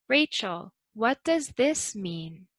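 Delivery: rising on does, dropping at the end; rise then fall. Shown as a demonstration. Teacher modelling a WH question, rising then falling. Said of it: "Notice how we go up and then down".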